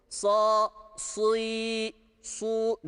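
Sa, si, su with a thick emphatic s; sad drill. Shown as a demonstration. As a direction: drill the heavy sad in each syllable. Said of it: "diye talim ettiğimiz". (sa si su'yu kalın sad harfiyle oku)

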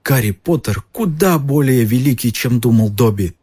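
high, tearful elf voice; sobbing elf. A narrator's reading. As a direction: choke up with gratitude. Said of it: "всхлипнул он".

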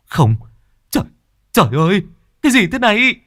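horrified high yell, rising sharply; loud shout. Acted. Hùng sees what his friend threw him, loud horrified shout. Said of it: "thì hét lớn".

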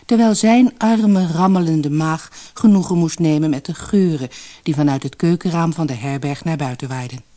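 ordinary delivery

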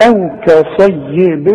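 أو كصيب recited slowly, lips closing on و; slow model reading of أو كصيب; slow recitation. Shown as a demonstration. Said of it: "شوف، اسمع". (تلاوة بطيئة لـ أو كصيب)

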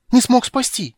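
high, loud, agitated cry; excited outcry. Acted. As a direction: blurt it out in alarm. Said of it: "выкрикнул Тасельхов в величайшем волнении".

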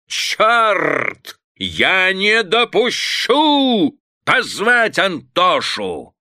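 high, slow, furious commands; father's mock fury, pitched high; angry outburst. A narrator's reading. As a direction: rage at the household. Enraged father gives orders.

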